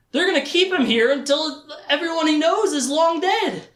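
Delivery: high, mounting outcry; horrified realization. Acted. Richard realizing he is trapped in time, high horrified outcry. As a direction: cry it out in dramatic dismay.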